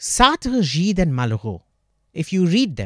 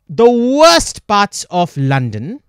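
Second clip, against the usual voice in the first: ordinary talk, nothing marked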